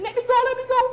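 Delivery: very high, loud squeal; frantic acted high-pitched pleading; panicked struggle. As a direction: squeal to be let loose. Grabbed boy struggles to escape.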